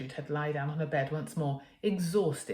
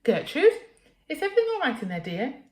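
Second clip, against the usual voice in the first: much higher, loud fussy mum voice; calling mother; 9 semitones higher, 6 dB louder than usual. Acted. Mother calling through the bedroom door, high. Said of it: "she called from the other side".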